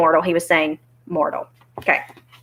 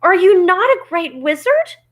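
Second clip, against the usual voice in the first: high, loud incredulous question; shocked cry; 12 semitones higher, 6 dB louder than usual. Acted. Dorothy questions the little man, crying out incredulous. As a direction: ask it loudly, up high in disbelief. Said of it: "cried Dorothy".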